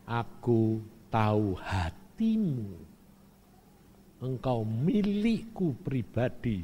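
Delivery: tender voice of God. Retold or imitated. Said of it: "Tuhan bilang".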